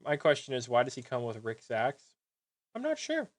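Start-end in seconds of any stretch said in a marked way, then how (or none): 2.7-3.3 sudden squeaky falsetto jump; squeaky high. Pretend. Reviewer squeaks up at himself over the axe, comic high pitch.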